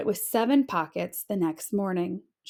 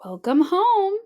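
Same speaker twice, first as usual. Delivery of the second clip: higher bright welcome; cheery mother; 8 semitones higher, 4 dB louder than usual. A narrator's reading. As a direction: greet her child happily. Mother greeting child.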